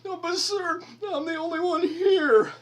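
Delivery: high, squeaky mimic of the young cashier; mock cashier voice. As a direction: whine it up high like a flustered clerk. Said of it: "she literally goes".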